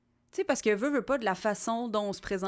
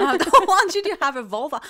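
laughing; 9 semitones higher, 9 dB louder than usual. Pretend. Echoing with higher pitch.

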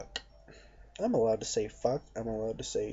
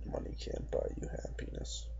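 mocking whisper; 9 dB quieter than usual. Repeating the cliché mockingly.

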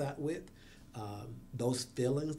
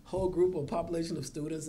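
loud, higher-pitched mock boast; sarcastic; 5 semitones higher, 8 dB louder than usual. Real, not acted. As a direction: push the absurd claim, easing off toward the end. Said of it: "I say that sarcastically".

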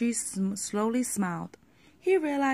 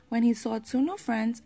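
ordinary delivery

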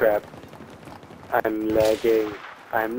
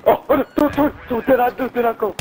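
panicked begging; 10 semitones higher, much faster than usual. Real, not acted. Panicked pleading.

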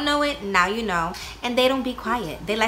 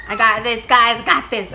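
louder, insistent self-assurance; calling out to her son to reassure him; loud pep talk; 9 dB louder than usual. Real, not acted. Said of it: "I'm telling my son".

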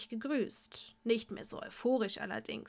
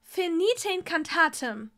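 loud, higher incantation; called spell; 6 semitones higher, 6 dB louder than usual. Acted. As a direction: cast the spell with a call.

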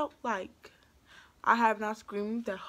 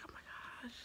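whispered, stunned aside; barely-there voiceless whisper; hushed shock; 19 dB quieter, much faster than usual. Retold or imitated. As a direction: whisper it in retold disbelief. Awed at seeing the birth.

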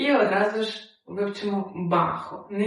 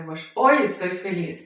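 usual speaking voice

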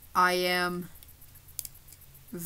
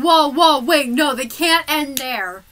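loud panicked protest; frantic protest; 5 semitones higher, 8 dB louder than usual. Retold or imitated. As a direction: shout it in a panic. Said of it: "I was literally saying".

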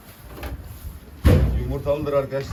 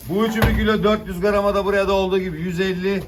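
auctioneer; 6 semitones higher, 5 dB louder than usual. Acted. Fish auction calling prices.

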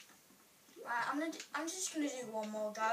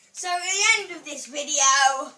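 booming, loud sign-off to viewers; closing the video, loud presenter voice; loud outro announcement; 4 semitones higher, 16 dB louder than usual. Real, not acted. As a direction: announce the ending loudly.

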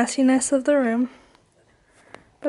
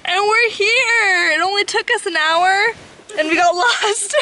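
excited; 7 semitones higher, 5 dB louder than usual. Real, not acted. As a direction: cheer it out loudly. Arriving after getting lost.

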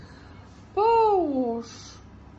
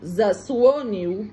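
Zasłonił said slowly and louder, each syllable za-sło-nił drawn out; slow and clear; 7 dB louder, slower than usual. Shown as a demonstration. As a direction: spell out Zasłonił slowly and loudly for the child to copy. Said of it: "Powiem ci na ucho".

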